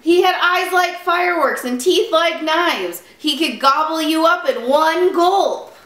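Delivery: high, dramatic storybook warning; excited alarm. Acted. Little Bear describes the wolf to Father Bear, excited and high.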